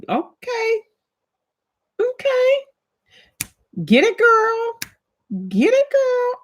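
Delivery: very high, singsong encouragement; cheering on. Real, not acted. Cheering someone going back to school, high-pitched hyping. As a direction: hype her up in a high squeal.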